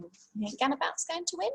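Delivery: ordinary delivery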